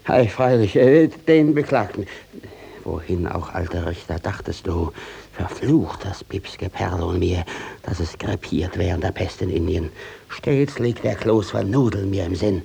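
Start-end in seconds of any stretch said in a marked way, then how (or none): 2.8-12.6 low and soft, grumbling to himself; muttered aside. Acted. Judge Adam frets to himself while the court waits, low and muttered. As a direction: mutter it under your breath, worried.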